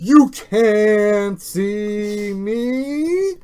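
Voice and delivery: slow, drawn-out loud catchphrase; John Cena catchphrase. Retold or imitated. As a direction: boom it out like the wrestler. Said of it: "like John Cena".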